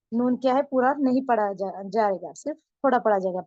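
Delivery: normal talking voice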